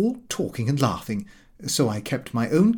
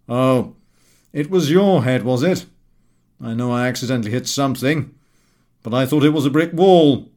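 amused Gowing voice; 6 dB louder than usual. A narrator's reading. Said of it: "He burst out laughing and said".

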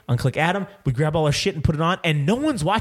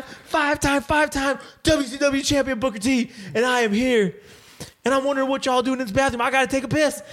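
high-pitched, hammed-up wrestler impression; much higher put-on showman voice; Booker T; 9 semitones higher than usual. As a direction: announce yourself like a wrestler making his entrance. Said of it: "Booker T comes fucking flying in there".

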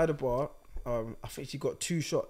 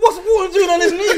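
high, loud laughing quote; 16 dB louder than usual. Real, not acted. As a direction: burst out laughing mid-line. Quoting own thought, cracking up.

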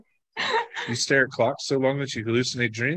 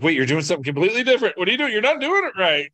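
alarmed protest; 13 semitones higher, faster than usual. Retold or imitated. Retelling panic at another tattooer.

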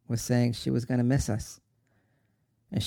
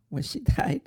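higher, breaking with a sob; sobbing; 5 semitones higher than usual. Acted. Son telling brother he saw mother die, sobbing. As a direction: let the grief break through.

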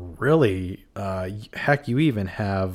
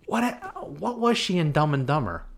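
normal talking voice

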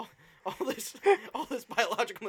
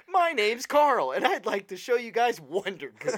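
pitchman; 3 semitones higher, 4 dB louder than usual. Pretend. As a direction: sell it to investors. Pitching to the sharks.

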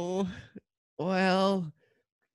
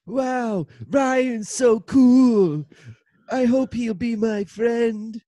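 excited; 5 semitones higher, 4 dB louder, slower than usual. Acted. Excited about the new brother.

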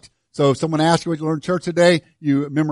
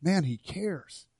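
soft testimony; 2 semitones higher, 10 dB quieter, slower than usual. Acted.

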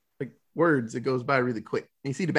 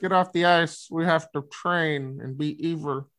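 put-on Icelandic accent. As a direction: ham up an Icelandic villain coach. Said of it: "I had an accent".

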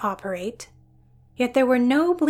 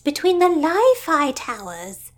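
much higher, louder, eager burst; excited sister; 5 semitones higher, 6 dB louder than usual. Acted. Gertrude explaining to Gladys, excitedly. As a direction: blurt it out, thrilled by the discovery.

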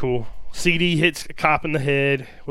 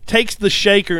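normal talking voice

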